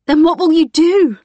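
loud, high, fed-up shout; exasperated shout. Acted. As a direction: throw it at her in frustration. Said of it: "I shouted, exasperated".

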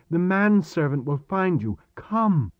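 high-pitched, pressing command; urgent insistence. Acted. Boy to hesitant Chris about Marek, urgent insistence. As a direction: urge him away before it is too late.

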